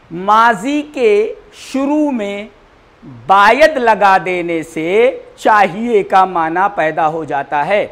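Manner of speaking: each word spaced out slowly; slow, stressed teacher delivery; slow emphatic rule. Shown as a demonstration. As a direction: drill the rule home for students. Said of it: "teacher stresses the rule".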